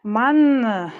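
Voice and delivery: a sudden loud burst of real frustration; frustrated shout. Real, not acted. Alone at the bead table, fed up with the beads, a loud frustrated shout.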